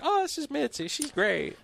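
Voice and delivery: high, breezy mom imitation; mom's voice. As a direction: chirp it like an easygoing mom.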